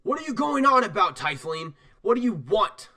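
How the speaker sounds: lightly voiced irritated demand; annoyed elf. Elf confronting Drathnor, lightly irritated. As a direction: snap the question. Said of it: "he says".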